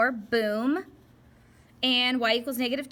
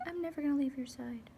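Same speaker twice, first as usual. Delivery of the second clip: light, higher sweet voice for a devoted line; lightly voiced doting friend, softer; parallel lines talking; 5 semitones higher, 4 dB quieter than usual. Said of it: "that's what their conversations are like".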